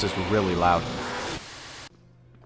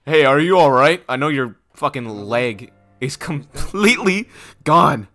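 mock concerned shout; 4 semitones higher, 6 dB louder, slower than usual. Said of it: "and you're like".

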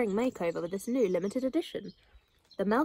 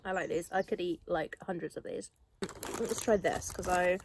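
chewing while talking; talking between bites; mouth full; 4 dB quieter than usual. Real, not acted. Seen 1: Puts snack in mouth at +0.5 and +13.3, chews between.

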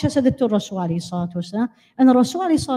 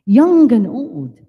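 put-on young and old drawn out, loud; slow emphatic preacher call; 6 dB louder, much slower than usual. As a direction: hammer each word slowly. Emphatic address to audience.

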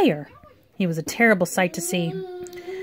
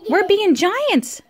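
put-on high, drawn-out little-girl announcement; excited girl; 10 semitones higher, much slower than usual. A narrator's reading. Trina tells other children the game, excited. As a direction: announce it high and slow to playmates.

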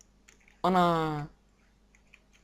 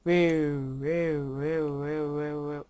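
mock noise; 3 semitones lower, much slower than usual. Mimicking a game noise.

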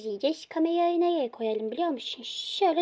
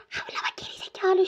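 sharp hushed scolding; whispering; 5 semitones higher than usual. Pretend. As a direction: hiss at the dog to stop. Hushing the barking dog. (fısıltıyla azarlama)

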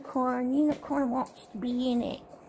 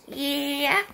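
ordinary talk, nothing marked

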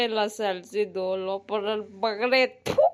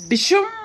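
loud high dishoom; punch sound; 9 semitones higher, 8 dB louder than usual. Acted. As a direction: shout the punch like a film fight. (ಜೋರಾದ ಹೊಡೆತದ ಸದ್ದು)

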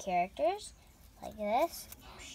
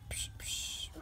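whispered punch sound effects; 8 dB quieter than usual. Pretend.

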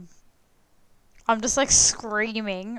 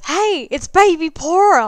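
loud, high mock shout of a fan; imitated excited call-out, pitched up and loud; 8 semitones higher, 10 dB louder than usual. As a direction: holler it like you spotted someone in a queue. Guy in club line calls out to her.

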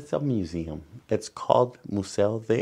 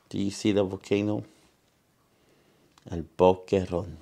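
lively bilingual picture-book reading; reading to children; 5 dB louder than usual. Acted. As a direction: read it out to the kids, bright and clear. Reads picture book aloud.

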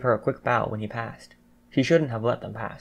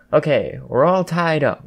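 loud, higher, measured announcement; announcing; 4 semitones higher, 6 dB louder, slower than usual. A narrator's reading. Yaoyorozu tallies the score for everyone, announcing. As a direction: announce it slowly to the room. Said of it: "Yoyozo announced".

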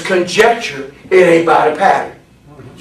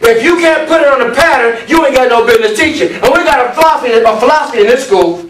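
high, raised preacher's cadence; forceful preaching; 8 semitones higher, 5 dB louder than usual. Real, not acted. Preacher warns the class what to preach, forceful preaching. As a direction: hammer each phrase out to the congregation.

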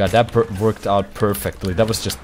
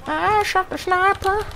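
mock sing-song echo; slower than usual. Echoing teammate's line mockingly.

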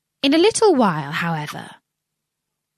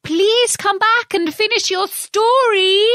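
much higher, louder, slow call; calling after; 13 semitones higher, 5 dB louder than usual. Acted. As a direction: call out to someone walking away. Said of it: "Alice called after it".

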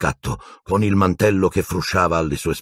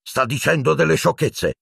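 higher-pitched exasperation; brusque bark; 9 semitones higher than usual. A narrator's reading. Minister dismissing the professor's claims, barking. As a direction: dismiss him testily, pitch raised. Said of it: "abbaiò Fudge".

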